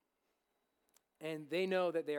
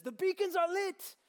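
much higher, loud heroic cry; yelling; 10 dB louder than usual. Acted. Aragorn shouting the news to King Theoden, full heroic yell. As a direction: shout it across the battlefield. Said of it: "he yells at him".

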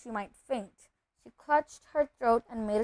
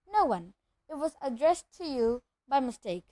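usual speaking voice

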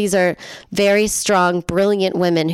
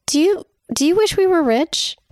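put-on higher-pitched child's question; asking kid; 10 semitones higher than usual. Kid asking a parent about money, higher child voice. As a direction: lightly put on a hesitant kid's voice. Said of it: "my kid asked me".

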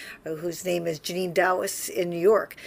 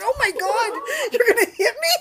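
higher, laughing mock panic; mock alarm, laughing; 6 dB louder than usual. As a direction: gasp out a laughing, half-scared question. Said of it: "I was like".

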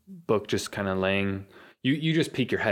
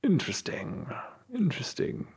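regal; 10 semitones higher, 3 dB quieter than usual. Acted. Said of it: "It's more deeper and regal".

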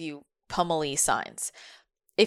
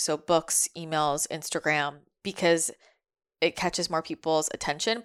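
ordinary talk

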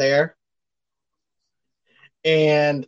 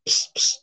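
psst for attention; 4 dB quieter than usual. Retold or imitated. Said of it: "He goes".

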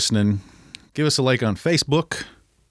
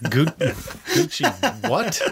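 put-on slow, higher, puzzled old-timer; old guy voice; 6 semitones higher, much slower than usual. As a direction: play the confused old man. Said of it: "I gotta act like I'm the old guy".

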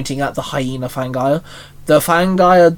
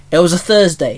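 ordinary delivery, nothing marked

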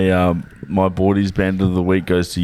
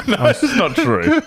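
high, laughing protest that it can't be true; laughing disbelief. Real, not acted. To the storyteller, refusing to believe the story, laughing. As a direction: crack up while denying it.

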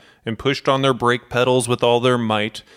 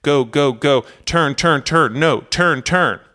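higher, louder urgent commands; narrated shout; 4 semitones higher, 5 dB louder, slower than usual. A narrator's reading. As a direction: shout the orders in a rapid string, as narrator. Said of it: "First Officer Lima shouts".

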